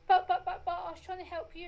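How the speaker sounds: mock childish protest tumbling out; whiny protest. Imitating her sister's defensive protest, whiny.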